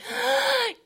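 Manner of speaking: high, loud drowning gasp for air; sharp gasp. Retold or imitated. Describing being knocked down by waves, sharp gasp. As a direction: gasp like a wave just hit you.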